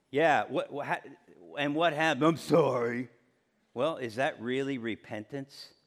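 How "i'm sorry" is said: mock insincere sorry, pitched lower; put-on reluctant apology; grudging child's apology. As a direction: act a kid forced to apologize. Child made to apologize to sibling.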